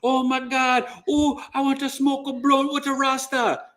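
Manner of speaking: a light mock of a giddy tourist.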